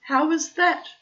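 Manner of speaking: high, loud roaring challenge; roaring lioness demanding an answer. Acted. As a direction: bellow it out in protest. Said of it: "roared a voice".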